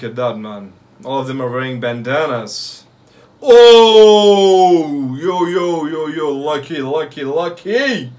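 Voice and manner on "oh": ordinary talk, nothing marked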